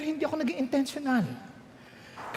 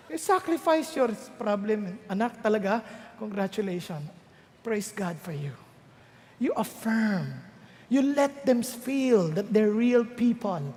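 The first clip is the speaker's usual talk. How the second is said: much lower and softer, slow; tender affirmation; 9 semitones lower, 4 dB quieter, slower than usual. Acted. Parent congratulating child tenderly, softly. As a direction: speak gently to the child.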